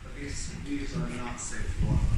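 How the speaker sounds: nervous night vlog whisper. Real, not acted. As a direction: hush it, careful. Hushed warning on weak floor.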